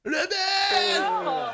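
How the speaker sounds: high, mocking yell of the name; triumphant taunting shout; jeering shout. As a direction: call him out to the crowd. Shouting out who lost.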